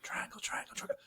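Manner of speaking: put-on sneaky whispering kids; whispered conspiring. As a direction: hush it like plotting a prank. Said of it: "we're like".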